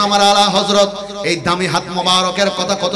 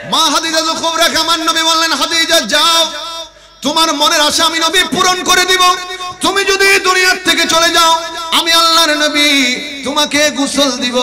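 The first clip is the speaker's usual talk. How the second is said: high, loud intoned promise; impassioned preacher voicing a solemn vow; full-force preaching; 10 semitones higher, 7 dB louder than usual. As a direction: proclaim it in a ringing sermon chant.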